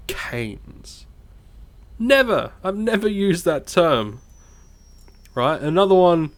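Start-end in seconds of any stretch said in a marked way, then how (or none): 0.1-1.0 whispered slang. Shown as a demonstration. 2.0-4.2 emphatic never. Real, not acted. Emphatic denial of using phrase.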